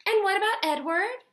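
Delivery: put-on high, loud, uncertain girl's voice. A narrator's reading. Abeline asking her parents about Edward, high and uncertain. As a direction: ask it worried, voice lifting. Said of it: "her voice high and uncertain".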